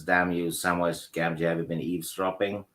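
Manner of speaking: ordinary talk, nothing marked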